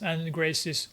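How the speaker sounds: ordinary talk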